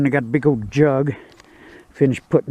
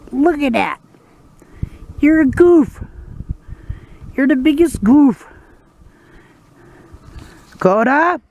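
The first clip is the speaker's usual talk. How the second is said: high, sing-song praise for the dogs; dog-talk voice; 9 semitones higher than usual. Real, not acted. Owner cooing high at his dogs.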